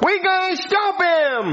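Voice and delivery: rally the team to fight; heroic battle cry. Acted. Heroes rally against the villain.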